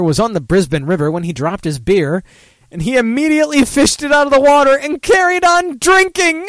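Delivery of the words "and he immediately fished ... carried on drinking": incredulous. Real, not acted. Reacting to gross news story.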